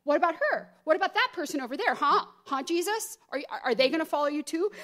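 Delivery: mock nosy questioning. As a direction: imitate someone deflecting onto others. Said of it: "look around at other people and be like".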